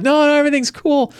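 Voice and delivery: high-pitched, hasty-sounding cover-up; mock reassurance. Party to the panicking player, mock reassurance. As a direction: imitate friends smoothing it over in a high voice.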